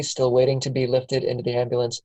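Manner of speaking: ordinary talk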